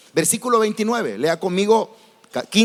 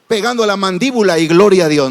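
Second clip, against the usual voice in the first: louder, blurted hammed-up cheer; mock loud praise; 8 dB louder than usual. Churchgoer hearing of wife-beating, hammed-up cheer. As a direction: shout praise at the wrong moment.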